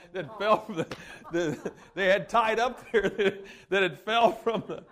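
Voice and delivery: words broken by laughter at the punchline; laughing while telling. Real, not acted. Preacher cracking up at his friend's rope, laughing. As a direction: let laughter spill through the words.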